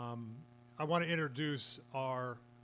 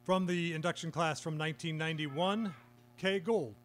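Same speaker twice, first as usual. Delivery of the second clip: announcer read; 2 semitones higher, 4 dB louder than usual. Real, not acted. Announces inductees by name.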